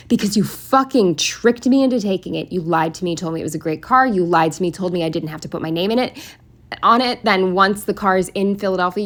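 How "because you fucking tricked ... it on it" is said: usual speaking voice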